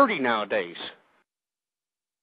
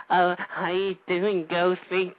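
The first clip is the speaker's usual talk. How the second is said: soft, slow, mincing mock of a caller; a lightly voiced limp-wristed caller impression; mocking effeminate caller; 3 semitones lower, 8 dB quieter, slower than usual. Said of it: "You sound like a goddamn fruity ass".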